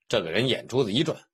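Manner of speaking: plain reading voice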